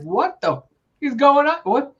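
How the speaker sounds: high-pitched, sputtering retold disbelief; exaggerated confused protest, voice shooting up; mock bewilderment. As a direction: squeak it out, utterly baffled. Retold disbelief at mom's switch.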